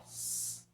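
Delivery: sibilant s alone; hissed s sound. Shown as a demonstration. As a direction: hiss a lone s. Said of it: "eu tenho só um som". ("s" sibilado isolado, sem voz)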